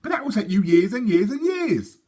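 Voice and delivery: mock-dismayed boys; scoffing twins. Twins scoffing at Sophie's savings, loud tease.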